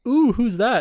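high, loud teasing ooh; mock the nosy friend leaning in. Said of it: "Brandon leans over and goes".

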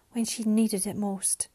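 ordinary delivery, nothing marked

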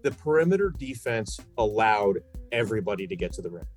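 ordinary delivery

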